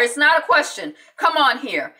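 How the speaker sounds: firm insistence. Retold or imitated. Coach insists on ER.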